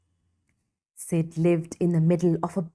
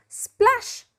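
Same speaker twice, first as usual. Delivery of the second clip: splash sound word. A narrator's reading. Ross falls in water.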